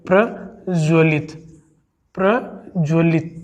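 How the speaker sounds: प्रज्वलित said slowly, each syllable apart; slow careful spelling. Shown as a demonstration. Teacher slowly modelling प्रज्वलित for the class. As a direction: slowly drill प्रज्वलित as the correct form. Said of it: "ये शुद्ध शब्द है साथियों".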